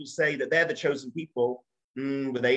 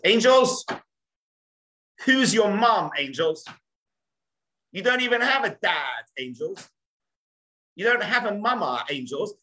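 high, loud taunt with a sing-song on angels; mocking teasing; 6 semitones higher, 3 dB louder than usual. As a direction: poke fun at the motherless angels. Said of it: "he starts making fun".

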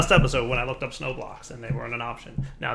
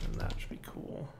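whisper; 11 dB quieter than usual. Real, not acted. Whispered aside to self.

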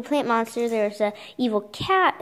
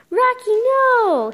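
high, slow cry of alarm; alarmed shout; 10 semitones higher, 6 dB louder, slower than usual. Acted. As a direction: shout his name in panic, much higher and louder. Acting out comic book.

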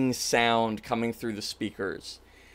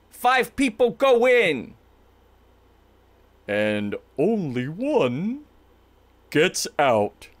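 Jimmy; 3 semitones higher, slower than usual. Acted. Jimmy's voice through the speakers, grand then ominous. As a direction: announce the act, then slow down with menace. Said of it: "You hear Jimmy's voice".

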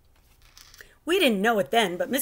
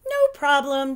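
bright, higher and louder breezy reply; teacher cheerily waving off the danger; cheerful; 6 semitones higher, 5 dB louder than usual. A narrator's reading. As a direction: toss it off with a carefree lilt. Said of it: "she said cheerfully".

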